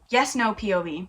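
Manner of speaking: ordinary talk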